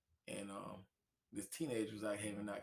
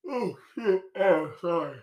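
startled; 8 semitones higher, 12 dB louder, slower than usual. Real, not acted. Knocks something, startled outburst.

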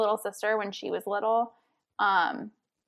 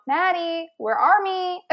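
mock child calling; 8 semitones higher, 5 dB louder, slower than usual. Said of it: "I'd go".

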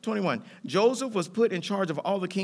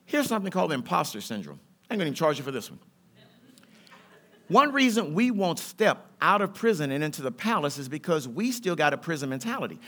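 normal talking voice

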